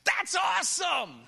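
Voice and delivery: high, loud, thrilled shout; a wife's overjoyed cry; excited wife. Retold or imitated. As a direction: burst out with delight. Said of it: "And she's like".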